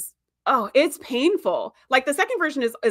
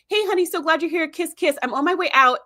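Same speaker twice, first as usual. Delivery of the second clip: lightly voiced higher, hurried-off wife; breezy wife; 6 semitones higher than usual. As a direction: imitate a wife tossing off a cheery goodbye. Retold wife dashing past husband.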